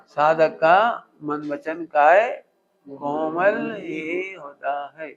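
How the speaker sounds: each word drawn out slowly, to be repeated back; slow repeat for the class. Shown as a demonstration. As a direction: spell it out slowly so they can repeat. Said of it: "क्या बोला?". (धीरे-धीरे दोहराने के लिए बोला गया)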